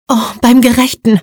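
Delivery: put-on young woman sees the beaten Mo and blurts out; shocked exclamation. A narrator's reading. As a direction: blurt it out at the sight of him. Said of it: "entfuhr es ihr".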